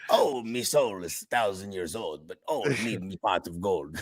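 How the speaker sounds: lightly voiced low leprechaun. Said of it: "like Leprechaun".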